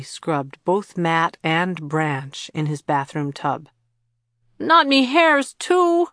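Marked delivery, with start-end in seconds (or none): 4.6-6.1 high, loud howl of protest. A narrator's reading. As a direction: wail it like a scrubbed child. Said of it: "Matt howled in protest".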